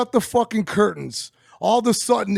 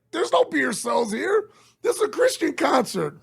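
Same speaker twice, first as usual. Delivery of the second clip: mock staff voice; 4 semitones higher than usual. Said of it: "they're like".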